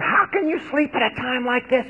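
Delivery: high, urgent shout at the sleeper; captain shouting down into the hold; shouted question. A narrator's reading. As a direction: rouse him with alarm. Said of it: "he shouted".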